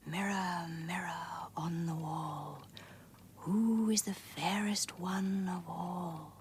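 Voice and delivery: slow whispered spell to the mirror; wicked queen whisper. Acted. Queen whispering to her magic mirror, slow. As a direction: breathe the words like a secret incantation.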